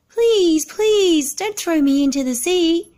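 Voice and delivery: high, loud begging scream; screaming plea. Acted. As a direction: beg at the top of a small voice. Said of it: "screamed the little turtle".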